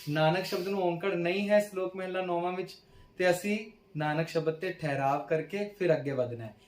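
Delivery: ordinary talk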